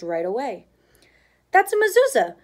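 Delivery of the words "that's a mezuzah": high, loud, thrilled exclaim; girl spotting the mezuzah, loud and thrilled; excited exclaim. A narrator's reading. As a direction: burst out with delighted recognition. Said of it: "Annie exclaimed".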